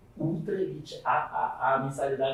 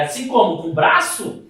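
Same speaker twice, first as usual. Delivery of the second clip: high, loud indignant comeback; acted-out angry sarcasm; retold anger; 8 semitones higher, 8 dB louder than usual. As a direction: fire the question back, voice raised. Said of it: "com raiva, falei".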